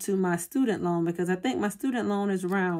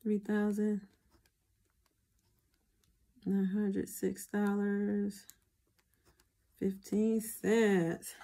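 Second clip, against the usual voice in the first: normal talking voice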